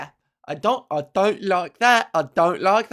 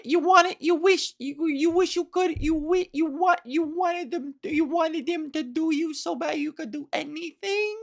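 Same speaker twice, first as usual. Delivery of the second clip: put-on high stuttering child voice; imitating a little kid stumbling over words; 8 semitones higher than usual. Mimicking the kid from the video, stuttering high. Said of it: "the child who said, who stuttered so adorably".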